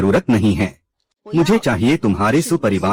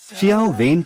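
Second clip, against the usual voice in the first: mock plea; 9 semitones higher than usual. Mocking captive's plea for help.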